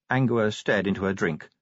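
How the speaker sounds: ordinary delivery, nothing marked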